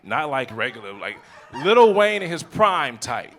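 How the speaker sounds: usual speaking voice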